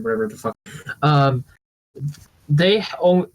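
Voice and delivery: usual speaking voice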